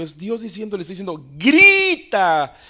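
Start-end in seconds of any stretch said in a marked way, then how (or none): 1.4-2.6 booming call to cry out with full strength; preacher voicing God's order to shout; God's command shouted. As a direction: shout it as God commanding the prophet. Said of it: "clama a voz en cuello".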